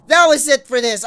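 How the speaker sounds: raging gamer. Real, not acted. Yells surrender at game.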